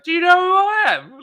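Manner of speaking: mock yell out of a Jeep window on a beer label; road-rage yell. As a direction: scream it out a car window. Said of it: "with me out the window going".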